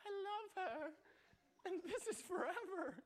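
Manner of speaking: mock the lovesick sixteen-year-old self; lovestruck teen. Teen insisting to his parents, hammed-up lovesick. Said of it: "this broken little brain was like".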